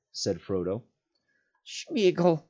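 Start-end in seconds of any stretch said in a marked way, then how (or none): 1.6-2.4 high clear creature call. A narrator's reading. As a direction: say it suddenly and clearly. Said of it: "suddenly and clearly".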